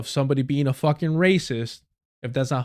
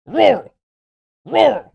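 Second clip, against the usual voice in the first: high, loud yelping barks of the word; barking dog; 8 semitones higher, 8 dB louder than usual. Acted. Streamer mocking rural voters as barking dogs. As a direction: bark "Rural" like a yapping dog. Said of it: "all they know how to fucking say".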